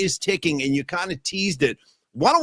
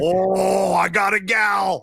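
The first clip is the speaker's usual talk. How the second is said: echoing stadium announcer; 3 semitones higher, much slower than usual. Retold or imitated. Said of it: "the in-stadium voice for the forty-niners".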